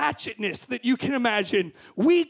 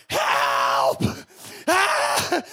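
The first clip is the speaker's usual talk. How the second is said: calling for help; 10 semitones lower than usual. Retold or imitated. Friends stuck calling for help.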